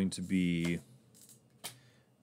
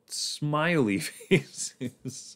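laughing; 6 semitones higher, much slower than usual. Real, not acted. Searching for the word, laughing.

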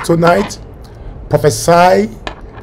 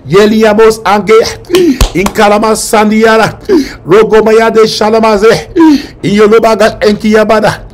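tongues; 3 semitones higher, 3 dB louder than usual. Acted. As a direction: pray in tongues with fervour. Speaking in tongues.

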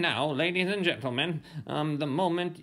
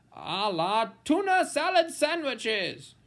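ordinary delivery, nothing marked

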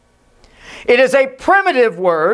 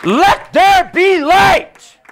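higher, louder, slow command; 6 semitones higher, 3 dB louder, slower than usual. Shown as a demonstration. Preacher voicing God's command, loud and slow. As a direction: proclaim it like God's own command. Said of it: "He said".

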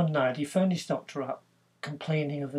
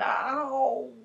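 high yelp of pain, a touch louder; 10 semitones higher, 4 dB louder than usual. Retold or imitated. Harry yelps again to the doctor, high. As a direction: cry out as the ear is touched. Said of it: "and he went".

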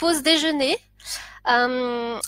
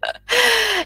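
delighted laugh; 10 semitones higher, 4 dB louder than usual. Real, not acted. Delighted by many viewers arriving.

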